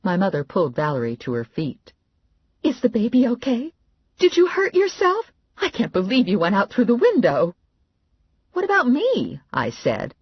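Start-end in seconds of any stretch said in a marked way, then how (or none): 2.7-7.6 frantic mother taking charge; mother's voice. Acted. Mother after the fall, high alarmed orders. 8.5-9.4 higher plaintive question; mild whine. Acted. [name] wanting attention too, a touch whiny.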